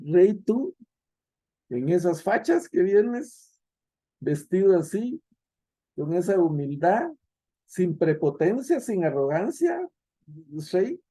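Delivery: preacher voices Pilate's mocking disbelief, sarcastic; sarcastic mockery. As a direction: sneer at a humble man called king. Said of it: "es una expresión burlona y sarcástica".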